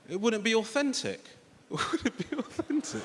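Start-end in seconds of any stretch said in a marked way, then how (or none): 1.7-3.0 high, silly mocking voice; mocking echo. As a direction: parrot it back in a high voice, laughing it off. Mockingly repeats his friend's words.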